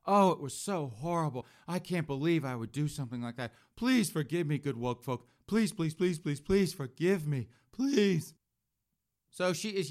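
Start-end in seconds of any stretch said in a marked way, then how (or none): 0.0-8.3 grovel and beg for forgiveness, overdone; mock groveling confession. Mocking Claire begging woke folk, hammed-up pleading. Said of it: "she came out and just confessed".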